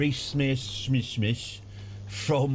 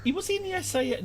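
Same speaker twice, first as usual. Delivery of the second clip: squeaky aside; faster than usual. Real, not acted. High quick aside.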